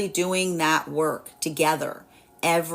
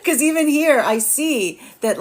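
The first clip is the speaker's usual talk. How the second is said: laughing; 7 semitones higher, 4 dB louder, slower than usual. Real, not acted. Laughing while explaining.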